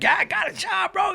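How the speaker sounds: high-pitched, giddy announcement; excited good news. Retold or imitated. Retelling the call to his sponsor, excited.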